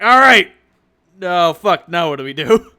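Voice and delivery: loud panicked outburst. Retold or imitated. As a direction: blurt it out in a panic. Gangers voiced, stumped after airstrip.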